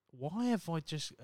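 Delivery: ordinary talk, nothing marked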